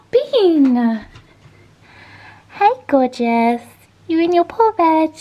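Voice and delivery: high, slow cooing at a pet; pet talk. Real, not acted.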